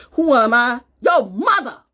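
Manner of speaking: loud, high outburst; mock confrontation. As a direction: call it out big and high.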